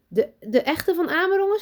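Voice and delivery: high, loud, stammering in awe. A narrator's reading. Teacher meeting the famous professor, stammering in awe. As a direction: trip over the words, starstruck. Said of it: "stamelt ze".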